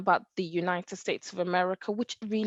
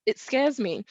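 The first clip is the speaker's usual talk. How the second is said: usual speaking voice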